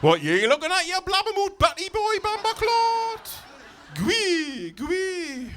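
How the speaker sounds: loud, high Geordie rant; Geordie accent. Acted. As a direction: snap at the family in broad Geordie. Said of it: "Geordie accent?".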